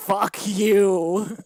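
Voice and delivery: annoyed retort. Retold or imitated. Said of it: "And I was like".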